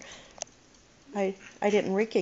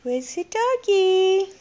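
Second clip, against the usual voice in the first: put-on reading to a toddler; 8 semitones higher, 4 dB louder than usual. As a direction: call out to a toddler, sing-song. Coaxing toddler to call dog.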